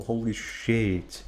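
normal talking voice

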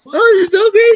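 hammed-up high squeal; mock excited. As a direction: fake over-the-top excitement. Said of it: "totally not a fake reaction".

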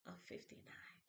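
unvoiced whisper to herself; hushed whisper. Real, not acted. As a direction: whisper it privately.

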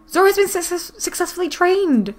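high, pleased proclamation; excited announcement. Real, not acted. Player reading that Zora is trained, high and excited.

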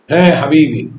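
ordinary talk

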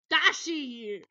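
loud call of the name. Real, not acted. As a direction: shout the name to get attention. Calling the pet.

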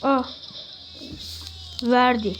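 normal talking voice

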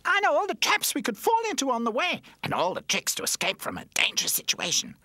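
high, loud eager boasting; flattered show-off toy. A narrator's reading. Flattered toy shows the others his know-how, eager boasting. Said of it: "Charlie Chip was flattered".

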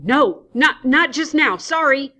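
high, loud panicked refusal; panicking. Acted. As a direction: blurt it out in a panic. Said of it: "Adams, panicking".

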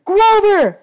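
loud high call; shouting a name. A narrator's reading. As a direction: yell to get through. Both shout at him.

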